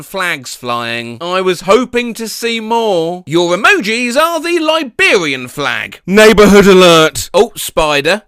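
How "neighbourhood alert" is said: louder mock announcer; announcer call. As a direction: announce it like an alarm. Announcing each silly alert.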